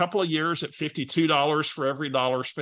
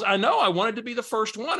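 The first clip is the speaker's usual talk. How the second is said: higher, eager sing-song caller; Barbara's voice; 9 semitones higher than usual. Retold or imitated. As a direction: play the eager friend who called first. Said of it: "I gotta use her voice".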